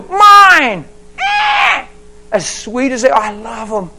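loud, high, fervent preaching; full-force preaching. Acted. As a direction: preach it out to the crowd.

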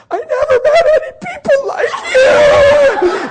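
high, loud mock wailing; imitated freak-out. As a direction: wail it like an overwhelmed waitress. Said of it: "Now she's freaking out".

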